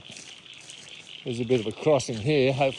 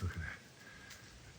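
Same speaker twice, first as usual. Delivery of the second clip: whisper; 7 semitones lower, 20 dB quieter, much slower than usual. Real, not acted. Watching the goanna up close.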